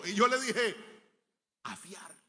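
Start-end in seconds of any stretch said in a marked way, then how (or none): 1.6-2.1 much softer, barely heard; ashamed reply. Retold or imitated. The boy to don Damián before the crowd, much softer. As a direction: mumble it in shame.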